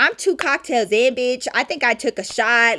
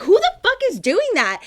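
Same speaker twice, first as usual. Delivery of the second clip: high-pitched, acted-out outrage; indignant demand; 10 semitones higher than usual. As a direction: demand to know who is messing with your man. Retold angry challenge in the club.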